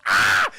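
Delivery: loud startled "ah!"; mock scream. She screams as the head crab falls on her, imitated scream. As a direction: imitate a sudden loud shriek. Retold scream as creature lands.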